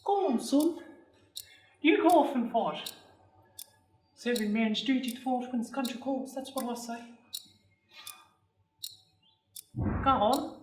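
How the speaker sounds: put-on high-pitched old crone urging him on; toothless old lady. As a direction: goad the boy as a toothless old woman. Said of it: "A toothless old lady".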